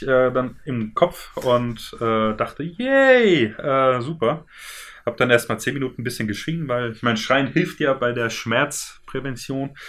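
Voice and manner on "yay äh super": sarcastic. Retold or imitated. Sarcastic yay after breaking leg.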